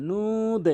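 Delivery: "নুদ" high, U stretched, D bounced; correct long NU. Shown as a demonstration. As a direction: hold the U of "নুদ" then release the D. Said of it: "তিন আলিফ টেনে এরকম উচ্চারণ করবে".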